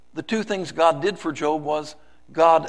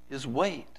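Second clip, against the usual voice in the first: ordinary talk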